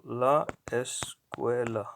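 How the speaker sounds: "la escuela" slowly, broken into la, es, cuela; slow word-by-word demo. Shown as a demonstration. Teacher showing the definite article, slow and syllable by syllable. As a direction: sound out "la escuela" with gaps between syllables. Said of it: "definite article".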